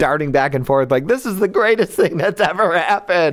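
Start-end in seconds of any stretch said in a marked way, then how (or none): 1.1-3.3 high, mildly hammed-up thrill; retold giddy amazement; acted delight. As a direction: gush as if witnessing a marvel. Acts own delight at the scene.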